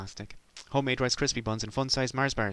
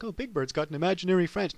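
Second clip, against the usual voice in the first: mocking taunt; 7 semitones higher, 3 dB louder than usual. Sesame characters mocking.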